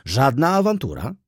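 lively rebuttal rising at the end; emphatic retort. A narrator's reading. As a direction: push back firmly, lifting "Żadna awantura". Doctor retorts to the muttered remark.

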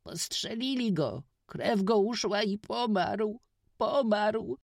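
put-on high, loud, tearful boy; crying out in tears. A narrator's reading. Witek tells the stranger Kuba was shot, in tears. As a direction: cry it through tears. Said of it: "wołał Witek przez łzy".

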